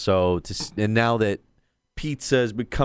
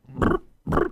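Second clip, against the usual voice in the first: choking gurgle. Retold or imitated. Imitating choking sounds.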